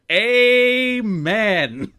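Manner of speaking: high, loud, shouted amen; enthusiastic amen. Retold or imitated. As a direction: hype it up like a preacher's sidekick. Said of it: "enthusiastically punctuates with".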